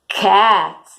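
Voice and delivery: ordinary talk, nothing marked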